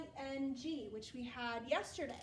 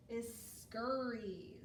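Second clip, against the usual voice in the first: x0.5 slow, scurries drawn out; slow dictation; 3 semitones lower, much slower than usual. Shown as a demonstration. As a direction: read the word slowly for spelling. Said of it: "our second word".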